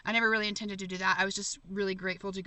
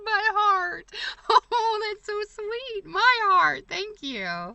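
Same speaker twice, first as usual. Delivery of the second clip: touched squeal; 12 semitones higher, 9 dB louder, much slower than usual. Real, not acted. Touched by viewer's sweet message.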